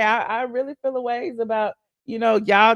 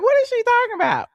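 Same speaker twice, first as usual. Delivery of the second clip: much higher, puzzled question; baffled disbelief; 12 semitones higher, faster than usual. Retold or imitated. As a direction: ask it way up high, baffled. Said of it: "I'm like".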